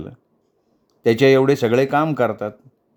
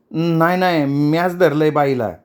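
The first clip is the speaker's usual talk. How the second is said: put-on higher-pitched childish insistence; mildly petulant denial; stubborn protest; 4 semitones higher than usual. A narrator's reading. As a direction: protest like a child who won't listen. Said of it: "Kamali हातपाय झटकत बोलली".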